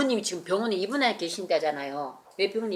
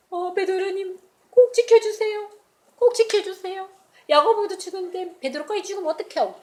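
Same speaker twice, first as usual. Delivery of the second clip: much higher, pleading prayer; desperate prayer; 10 semitones higher, 4 dB louder than usual. Acted. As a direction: beg in earnest for his safety. Church members praying desperately. (간절하게 매달리는 기도 목소리)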